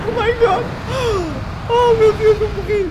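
very high, loud shriek of fright; panicked scream. Real, not acted. Player sure they have just died, panicked scream. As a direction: squeal it out at the top of the voice.

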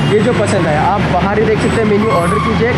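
muffled behind respirator; speaking outdoors masked; through a face mask. Real, not acted.

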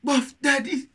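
high mock yell of the hook; imitating the rapper. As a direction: belt the rapper's two words back. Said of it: "Man say".

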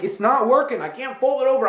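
high, exasperated complaint; aggravated. Retold or imitated. Man complaining at his bill, aggravated. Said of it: "get aggravated".

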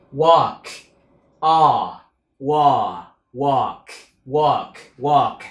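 "walk" with an open o, not "work". Shown as a demonstration. Teacher correcting learners' walk, stressed open o. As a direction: build "walk" from the o sound. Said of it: "Mình đừng đọc là work nha".

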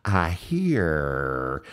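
lightly voiced old Alabama friend saying "I hear"; imitating Norman Holland. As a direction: mimic his catchphrase "I hear" in his voice. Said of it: "He'd always go".

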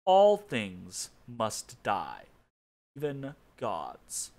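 slow, heavy, looming words; ominous pronouncement. Acted. Myrkul the necromancer gloating to his rival Bane, slow and ominous. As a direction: toll it out like a death knell.

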